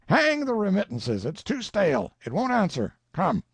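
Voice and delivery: high, loud brush-off; impatient. Acted. Collector dismissing the Colonel's remittances, impatient and loud. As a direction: slap the excuse away.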